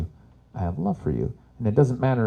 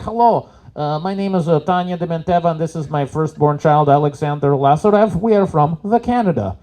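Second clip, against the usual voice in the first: lightly voiced Russian accent, higher; mock Russian accent; 4 semitones higher, 5 dB louder than usual. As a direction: mom faking Canadian with a thick Russian accent, hammed-up. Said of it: "she walks up like".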